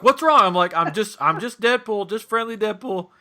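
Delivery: loud, high retold question; alarmed asking. Costumed man to a screaming girl, loud alarmed question.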